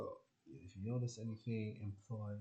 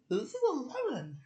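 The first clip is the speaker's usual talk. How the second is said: high, loud put-on wonder; mock amazement; 6 dB louder than usual. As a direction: exclaim it like a astonishing discovery, pitched way up. Acting out discovering a lemon.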